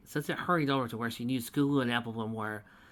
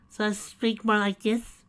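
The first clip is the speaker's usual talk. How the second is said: high, slow posh drawl; drawn-out upper-class affectation; upper society drawl; 9 semitones higher, 6 dB louder, slower than usual. Acted. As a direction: imitate the snooty art voice. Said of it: "upper society drawl".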